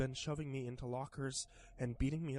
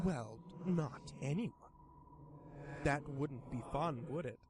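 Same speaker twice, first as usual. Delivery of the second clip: sly creature voice; 2 semitones higher, slower than usual. Acted. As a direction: tease like a grinning demon. Pastel creature speaks.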